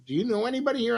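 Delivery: high, fast questioning voice; mock stranger. Wedding guest quizzing the crashers, high and quick. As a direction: squeak it out quickly like a nosy guest. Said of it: "somebody goes".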